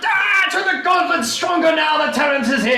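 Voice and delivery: high, loud shouted toast; roaring, celebratory salute; jubilant toast. Acted. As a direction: holler it to the whole hall. Crusader answers the toast.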